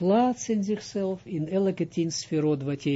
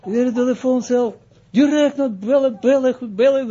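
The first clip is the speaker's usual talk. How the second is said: excited; 8 semitones higher, 8 dB louder than usual. Retold or imitated. Retold panic to phone.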